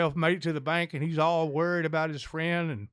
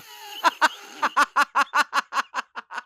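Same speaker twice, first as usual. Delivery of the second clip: loud, high burst of laughter; long cracking-up laugh; helpless laughter at a punchline; big laugh; 7 semitones higher, 5 dB louder than usual. Real, not acted. Joke punchline lands.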